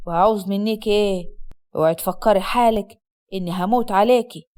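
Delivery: low-pitched Upper Egyptian husband; scornful husband. Acted. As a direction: drop low and taunt the wife. Voices the cold husband. (زوج صعيدي ساخر بصوت واطي)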